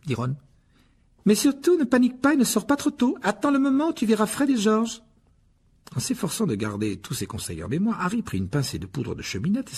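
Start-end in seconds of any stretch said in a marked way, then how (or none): 1.2-5.0 higher motherly voice; a brisk mother of many sons; Mrs Weasley. Acted. Molly voiced. (voix de mère plus aiguë)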